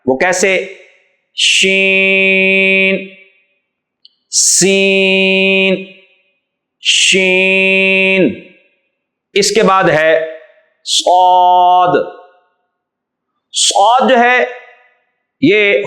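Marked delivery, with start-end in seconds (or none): 1.4-8.3 شین drawn out very slowly; شین held extra long, hushing sh; long sheen. Shown as a demonstration. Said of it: "تھوڑا سا لمبا کر کے پڑھا جائے گا". 10.9-11.9 صاد drawn out very slowly with a thick heavy s; thick saad. Shown as a demonstration. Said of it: "آواز جو ہے وہ موٹی ہوتی ہے".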